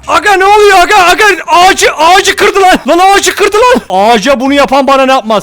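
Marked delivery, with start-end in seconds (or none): 0.1-3.8 high loud shocked shouting. Real, not acted. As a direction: yell in disbelief. Shocked monster breaks tree.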